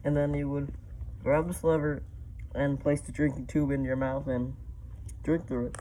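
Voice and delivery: muffled voice inside a mask; through a gas mask. Real, not acted. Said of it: "show you guys what it's like with me in it".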